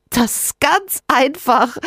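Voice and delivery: slow, high giggly creature voice; giggling. Acted. Rinus giggles as he answers Sophia. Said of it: "kichert Rinus".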